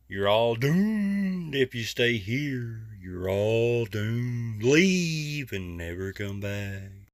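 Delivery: slow, soft ghostly warning; slow ominous raspy drawl. Pretend. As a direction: drag it out like a ghost.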